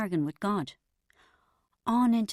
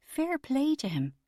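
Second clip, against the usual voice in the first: grudging admiration; 7 semitones higher, slower than usual. A narrator's reading. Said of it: "a part of me thought".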